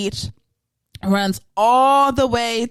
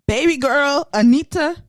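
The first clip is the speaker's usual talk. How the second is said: ordinary talk, nothing marked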